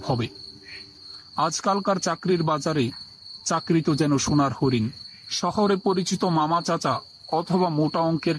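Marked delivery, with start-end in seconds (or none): none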